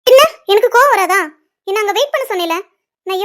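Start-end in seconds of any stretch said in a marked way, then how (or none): 0.1-1.2 louder, higher indignant cry; angry outburst. Acted. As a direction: let the anger burst out. Tara angry at being kept waiting.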